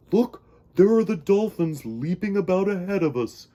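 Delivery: a whale calling out happily to his friend; happy cry. Acted. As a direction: point them out with delight. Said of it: "cried Walter happily".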